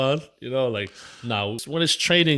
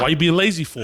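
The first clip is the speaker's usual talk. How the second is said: louder, higher mock coach scold; 6 semitones higher, 5 dB louder than usual. Coach scolds his lazy fighter, mock yell. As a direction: needle him for slacking off. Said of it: "He's like".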